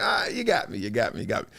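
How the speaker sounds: low, soft admission of defeat; put-on sheepish surrender, trailing low; mock conceding. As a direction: give in quietly, dropping the pitch. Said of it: "I'm like".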